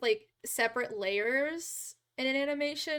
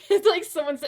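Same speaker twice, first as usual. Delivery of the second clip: loud laugh; 9 semitones higher, 15 dB louder, faster than usual. Real, not acted. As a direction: burst of laughter. Bursts out laughing at chat.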